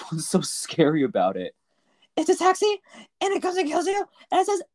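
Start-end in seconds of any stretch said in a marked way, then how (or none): 2.2-4.6 squeaky high joking voice; high mock voice. Describing a killer taxi in a high silly voice. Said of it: "joking scary taxi".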